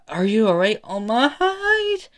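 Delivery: loud question climbing very high; worried kid, voice shooting up; alarmed concern. Acted. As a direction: squeal the name in alarm. Kid alarmed after rooftop fall.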